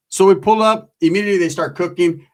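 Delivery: ordinary delivery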